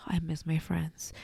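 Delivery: whispering, choked up; real tearful whisper to friends; emotional whisper. Real, not acted. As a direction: let the feeling break the voice into a whisper. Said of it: "I'm gonna get emotional".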